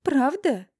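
high, loud, eager exclamation; hopeful exclamation. Acted. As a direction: exclaim with clear hope. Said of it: "Энергично восклицаю я с очевидной надеждой".

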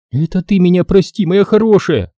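high, tender, tear-choked; mildly teary mother hugging her daughter; choking on tears. A narrator's reading. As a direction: forgive her through your tears. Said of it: "давилась слезами Лариса".

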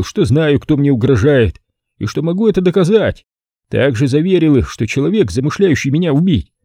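a confident host relishing his plan; Stanton's voice. A narrator's reading.

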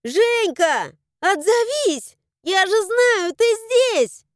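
high, loud, urgent shouting; frantic high-pitched holler; straining desperate yell. A narrator's reading. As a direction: scream for an answer, straining the voice. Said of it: "заорала ещё громче, срывая голос".

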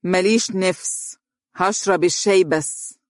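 mildly snooty, aloof refusal; haughty. A narrator's reading. Donia turning from mother-in-law, haughty. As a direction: brush the offer off with disdain. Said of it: "وقالت بغطرسة".

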